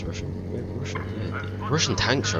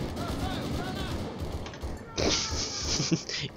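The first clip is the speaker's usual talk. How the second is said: high, breathless giggle at himself; squeaky laugh; 7 dB quieter than usual. Real, not acted. Gamer laughing at his own battle cry, squeaky giggle.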